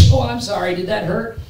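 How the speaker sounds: high-pitched mock concern; mock apology. Preacher imagines those beating Jesus apologizing, mock concern.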